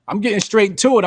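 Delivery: ordinary delivery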